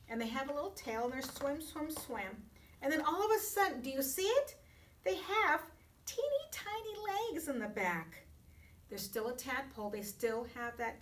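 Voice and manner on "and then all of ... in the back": high, fast and eager; excited. Acted. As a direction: build up the big reveal. Excited reveal to children.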